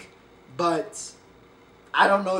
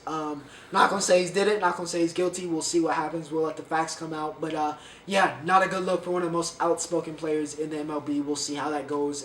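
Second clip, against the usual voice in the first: ordinary delivery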